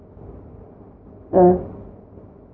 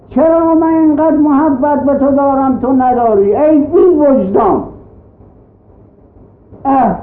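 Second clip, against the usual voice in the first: higher, impassioned preacher voice voicing God; fervent pulpit plea, pitched up; preaching God's plea; 6 semitones higher than usual. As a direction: plead as God calling back his creatures. Voices God pleading with man.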